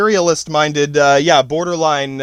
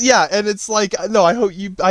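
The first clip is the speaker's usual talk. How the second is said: ordinary talk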